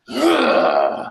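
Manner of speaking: deep annoyed grunt; low loud groan. Retold or imitated. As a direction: groan at the request. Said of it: "And I'm like".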